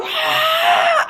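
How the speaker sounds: high, loud mock scream; reenacted scream. Storyteller acting out waking to a spirit, screaming. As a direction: let out a startled shriek, acting it out. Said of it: "I start screaming".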